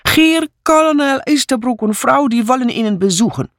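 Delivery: very high, brash announcing; high, hurried proclamation of guests; maid's blunt announcement. A narrator's reading. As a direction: announce the visitors in a peculiar, blaring way. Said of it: "Sie hatte ihre eigene Art, Besucher anzumelden".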